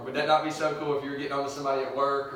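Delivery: ordinary delivery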